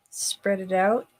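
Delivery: ordinary talk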